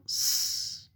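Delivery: long sustained hiss for "S"; pure held sss on "S"; isolated S sound. Shown as a demonstration. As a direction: say "S" as a drawn-out sss, not the letter name. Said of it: "Le son S".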